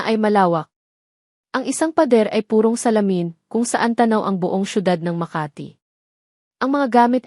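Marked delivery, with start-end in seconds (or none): none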